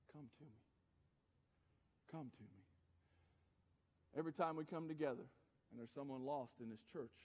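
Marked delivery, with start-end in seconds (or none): 0.1-2.6 very soft, low invitation; gentle calling. Acted. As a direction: call tenderly, almost under your breath. Voicing Jesus gently calling.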